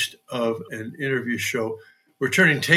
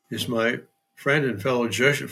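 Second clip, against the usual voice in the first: usual speaking voice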